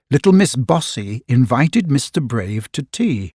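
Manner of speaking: ordinary delivery, nothing marked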